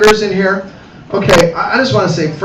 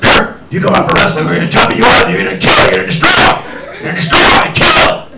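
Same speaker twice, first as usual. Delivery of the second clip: violent coach hype; 2 semitones lower than usual. Retold or imitated. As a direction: psych him up like a war chief. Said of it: "they're like".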